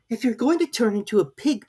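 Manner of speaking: put-on high, lightly girlish Alice; young girl scolding a baby; Alice's voice. A narrator's reading. Alice voiced in read story.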